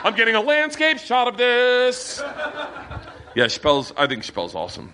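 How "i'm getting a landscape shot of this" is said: high, slow gawker voice; lightly voiced high onlooker; mock dopey bystander. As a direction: drawl like a guy filming a brawl. Filming a fight on phone.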